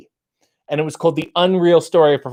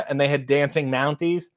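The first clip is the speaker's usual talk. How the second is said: ordinary talk, nothing marked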